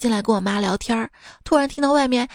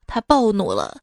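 ordinary talk, nothing marked

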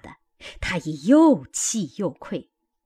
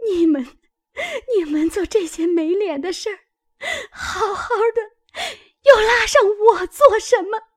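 high-pitched tearful protest; angry crying; 12 semitones higher, 3 dB louder than usual. A narrator's reading. Wronged maid cursing the lovers, crying in anger. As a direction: wail it, far higher, louder at the end. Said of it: "只气得干哭".

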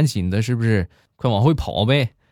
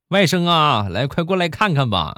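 acted eager uncle, pitched higher; higher, eager mock-uncle voice; excited uncle; 7 semitones higher than usual. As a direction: call your nephew over, lightly excited. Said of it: "我大舅很激动的就说".

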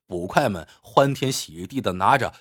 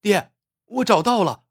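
excited high-pitched call; 7 semitones higher than usual. A narrator's reading. Fisherman's young son calls his father to the find, calling out. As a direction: call out the find, mildly raised. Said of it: "高声喊道".

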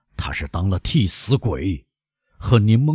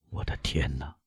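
murmured under the breath, much softer; 5 semitones lower, 11 dB quieter than usual. A narrator's reading. Despairing prosecutor murmurs to himself, softly. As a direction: Villefort murmurs it to himself in dismay. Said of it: "维勒夫喃喃地说".

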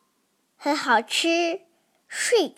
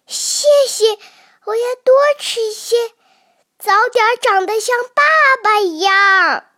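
put-on high, quick little child; eager baby voice; 5 semitones higher, 4 dB louder, faster than usual. Baby dinosaur telling papa it will grow up like him, high and fast. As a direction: chirp it out fast and proud.

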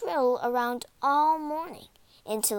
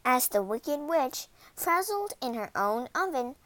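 usual speaking voice

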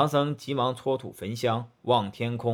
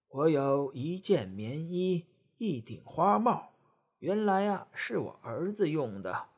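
a hint of a kindly old woman; 5 dB quieter than usual.

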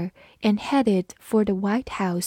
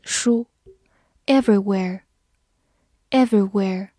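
ordinary talk, nothing marked

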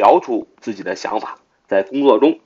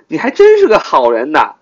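raised, louder mock praise; sarcastic; 5 semitones higher, 5 dB louder than usual. Shown as a demonstration. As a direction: say it as a pointed backhanded compliment. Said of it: "我讽刺地说". (讽刺的语气)

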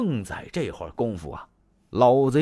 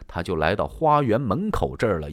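ordinary delivery

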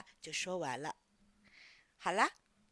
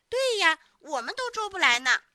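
put-on high, loud kids' chiming in; chorused agreement; 7 semitones higher, 12 dB louder than usual. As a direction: chime in loudly like a group of doubters. Children all agree, doubting him.

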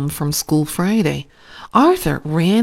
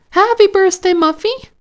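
high, loud birthday cheer; excited greeting; 14 semitones higher, 6 dB louder than usual. A narrator's reading. Host greets surprised birthday girl.

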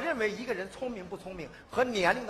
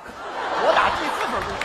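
ordinary talk, nothing marked

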